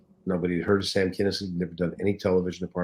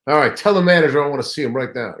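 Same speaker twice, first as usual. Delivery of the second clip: loud, high indignant outburst, faster at the end; outraged yell; 8 semitones higher, 8 dB louder than usual. Retold or imitated. Star yelling at staff over billing, loud outraged. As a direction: blow up about the missing name on the marquee. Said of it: "He said".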